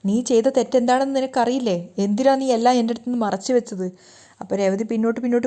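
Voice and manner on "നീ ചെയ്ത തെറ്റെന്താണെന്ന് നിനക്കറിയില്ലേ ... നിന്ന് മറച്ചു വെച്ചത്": usual speaking voice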